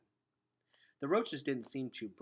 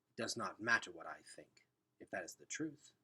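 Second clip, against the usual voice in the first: evasive; 3 semitones lower, 5 dB quieter than usual. Acted. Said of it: "said Luxa evasively".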